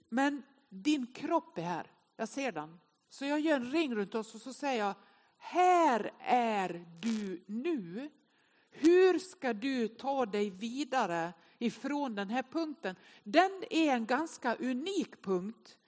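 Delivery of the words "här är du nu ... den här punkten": drawn-out words, deliberate and measured; slow proclamation. Acted. Preacher addressing those inside the ring, slow deliberate proclamation. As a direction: declare it slowly, letting each word land. Declares it to the congregation.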